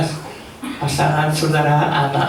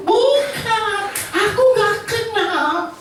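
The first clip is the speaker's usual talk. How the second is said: high, squeaky, slow denial; a hammed-up panicky "no, I don't know him"; nervous denial; much slower than usual. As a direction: play Peter caught out and squirming. Peter denies knowing Jesus.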